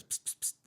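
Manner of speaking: repeated unvoiced hiss for attention; quick, insistent psst; hushed psst. Emergency service guy calling the cop back, hushed psst.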